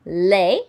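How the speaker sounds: "Les" rhyming with ray, not luh; model word. Shown as a demonstration. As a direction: call out "Les" loudly as a model. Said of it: "does not sound like le or de or ne".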